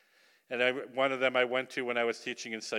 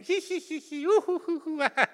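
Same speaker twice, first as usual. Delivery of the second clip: high squeaky embarrassed giggles, hee hee hoo; imitated giggling. Preacher imitates Russian girls' embarrassed high giggling. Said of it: "the young girls are all going".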